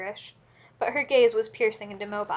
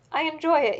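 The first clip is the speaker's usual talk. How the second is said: put-on higher, louder cry of a beaten drunk; crying out meekly; 6 semitones higher, 5 dB louder than usual. A narrator's reading. Husband dragged by the hair cries out, higher and louder. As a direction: plead it out while being dragged. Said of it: "he kept crying".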